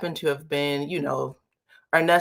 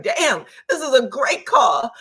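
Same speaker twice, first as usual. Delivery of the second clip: high, loud, hammed-up exclamation; excited mock reaction; 7 semitones higher, 5 dB louder than usual. Host retelling their reaction to a leaked call, mock excitement. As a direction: exclaim it like an impressed listener. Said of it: "I was like".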